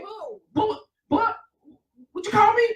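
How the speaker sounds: a hint of an offended king, pitched high; lightly voiced indignant king; mock outraged king. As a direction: play the king taking offence. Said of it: "He's talking to the king and saying".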